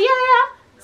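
quick, high, keen yeahs; eager agreement. Retold or imitated. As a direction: jump at the offer. Eager self quote.

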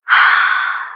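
long unvoiced sigh of giving in; exasperated sigh before agreeing; weary sigh. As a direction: let out a tired, put-upon sigh. Reluctant student agreeing grudgingly.

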